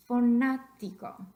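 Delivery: slow, loud fanático with NA punched; emphatic teaching demo of fanático, stressed on NA. Shown as a demonstration. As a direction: hit the second syllable hard, faNÁtico. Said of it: "over that A is where we're getting our voice stress".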